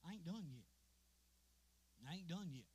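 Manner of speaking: ordinary talk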